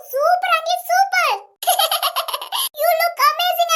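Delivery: high-pitched, gushing compliments; gushing praise. Acted. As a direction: fawn over auntie with delight. Women gush over aunt's looks. (ഉയർന്ന സ്വരത്തിൽ പുകഴ്ത്തുന്നു)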